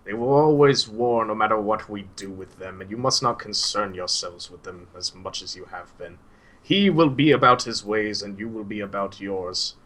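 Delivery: put-on grave counsel from an old teacher; grim old Suhail. Old teacher gravely counselling his troubled student. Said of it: "grimly looks down, and he's like".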